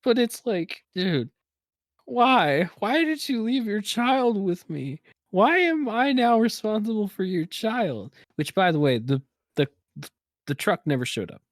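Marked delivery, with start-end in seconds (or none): 0.0-8.1 high-pitched, put-upon demand; mock pleading, pitched up in protest; exasperated plea. Store worker to parent who left a child, exasperated plea. Acted complaint to the absent parent.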